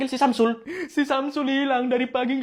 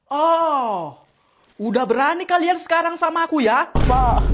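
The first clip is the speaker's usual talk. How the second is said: usual speaking voice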